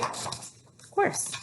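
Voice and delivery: a knowing whisper. Acted. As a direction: whisper it like a secret. Teasing answer to children.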